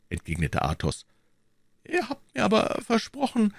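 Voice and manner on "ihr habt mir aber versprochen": put-on high, timid old shopkeeper; faltering stammer. A narrator's reading. Old shopkeeper reminding musketeers of their promise, timid stammer. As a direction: protest weakly in a high voice. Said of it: "stammelte ganz leise der alte Krämer".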